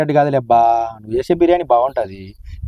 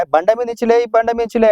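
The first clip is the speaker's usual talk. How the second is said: mocking her nagging; 8 semitones higher than usual. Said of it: "అంటావు".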